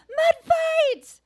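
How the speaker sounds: high, loud playful battle cry; playful shout. Retold or imitated. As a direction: call it out to start the game. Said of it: "as playfully as I could".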